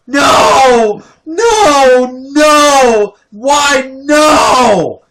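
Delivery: very high, loud crying screams of no; hammed-up mother wailing no; mom's wailing shout. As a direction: imitate mom wailing in despair. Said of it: "here's her reaction".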